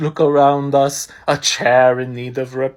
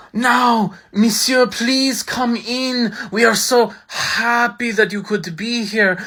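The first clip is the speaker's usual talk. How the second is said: ordinary delivery, nothing marked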